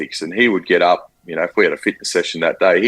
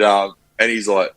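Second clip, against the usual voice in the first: usual speaking voice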